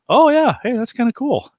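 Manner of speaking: high, bright and loud; impressed. Retold or imitated. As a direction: pitch it way up, pleasantly surprised. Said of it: "the community to go".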